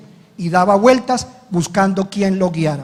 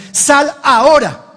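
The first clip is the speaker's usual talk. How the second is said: loud, slow, higher command; final rebuke; 5 semitones higher, 4 dB louder, slower than usual. Retold or imitated. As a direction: drive the demon out with each word.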